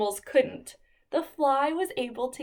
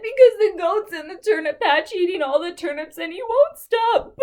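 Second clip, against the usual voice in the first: fast, high, louder wail; crying animals complaining to the wolf; 7 semitones higher, 5 dB louder than usual. Acted. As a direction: blurt it out in a hammed-up sob. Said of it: "they said".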